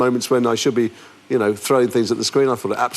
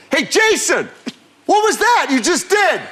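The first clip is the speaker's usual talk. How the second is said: imitating a shouting director; slower than usual. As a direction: bark it across the set like an angry director. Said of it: "he's a shouter".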